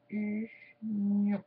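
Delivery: drawn out very slowly; slowed down. Shown as a demonstration. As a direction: stretch the word out, slow motion. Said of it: "je fais au ralenti pour vous".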